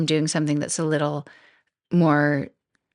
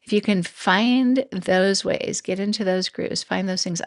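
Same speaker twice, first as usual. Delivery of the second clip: normal talking voice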